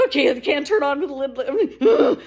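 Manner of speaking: laughing exasperation. Retold or imitated. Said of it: "And I'm like".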